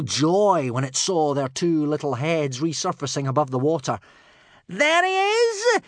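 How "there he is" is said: very high, loud screech; drawn-out villain's yell; shouted. Acted. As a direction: scream it long and high. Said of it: "shouted Alvin".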